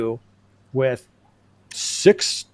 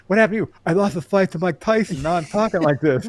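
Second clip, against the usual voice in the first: lisping boxer voice, pitched up; Mike Tyson impression; 5 semitones higher, 4 dB louder than usual. Host jokes he lost to the boxer, lisping Mike Tyson impression. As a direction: do a comic high, lisping Tyson. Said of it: "Now I talk like him".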